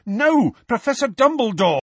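loud, high, urgent cry; desperate protest. A narrator's reading. Harry trying to stop Dumbledore, loud and urgent. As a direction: call out to stop him. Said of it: "he could not let it happen".

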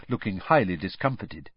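ordinary delivery, nothing marked

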